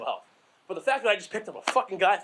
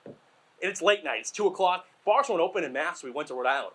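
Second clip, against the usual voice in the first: ordinary talk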